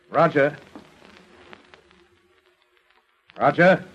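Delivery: calling out. Acted. Calling to a silent patient.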